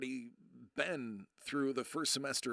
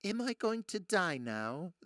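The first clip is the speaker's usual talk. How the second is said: a lightly voiced slow little gnome; a hint of a slow, worried gnome voice; Travelocity gnome; 4 semitones higher, slower than usual. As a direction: ask it slowly like a worried cartoon gnome. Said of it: "the Travelocity gnome from the commercial".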